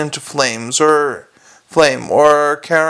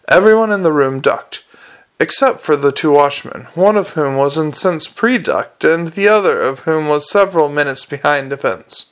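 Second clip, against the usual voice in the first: plain reading voice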